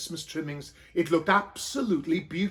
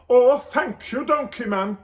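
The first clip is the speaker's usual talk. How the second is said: higher and louder, gushing thanks; grateful Joseph; 6 semitones higher, 8 dB louder than usual. Acted. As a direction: gush with relief. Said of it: "said Joseph".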